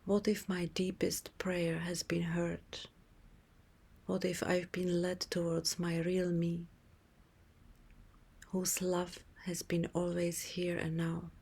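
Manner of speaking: ordinary delivery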